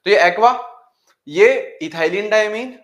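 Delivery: normal talking voice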